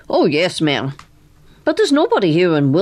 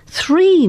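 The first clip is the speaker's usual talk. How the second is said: Three punched much higher and louder; stress on Three; 12 semitones higher, 4 dB louder than usual. Acted. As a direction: hit Three hard, no less. Bishop stressing the count.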